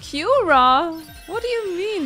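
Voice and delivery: loud, high incredulous question; shocked disbelief. Real, not acted. Gamer seeing a fiend heal itself, shocked exclamation. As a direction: blurt it out in shock. Shocked at enemy's spell in game.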